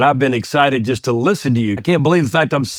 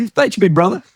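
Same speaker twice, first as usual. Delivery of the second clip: playful thanks; 7 semitones higher than usual. Acted. Playful reply to host.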